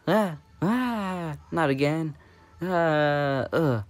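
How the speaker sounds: high, loud cries of dismay. Pretend. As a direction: yell as you're caught again. Character caught, cries out.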